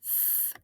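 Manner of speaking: whispered "f" sound, a voiceless hiss; just the airy "f", no vowel; letter sound. Shown as a demonstration. Teacher isolating the first sound for students, voiceless. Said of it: "we know the first sound".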